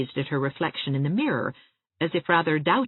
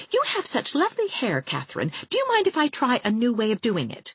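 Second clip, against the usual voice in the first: put-on higher, warm encouraging girl voice; affectionate friend, pitched up; Anne, reassuring; 6 semitones higher than usual. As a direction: lift her up gently and brightly.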